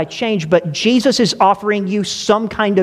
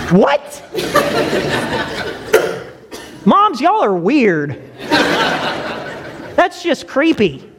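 laughing; 7 semitones higher, much slower than usual. Real, not acted. Laughing at mom's intuition.

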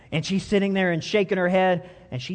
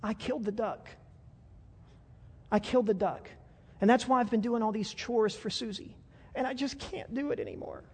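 crying confession; 5 semitones higher, 8 dB quieter than usual. Acted. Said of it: "he starts to cry and he says".

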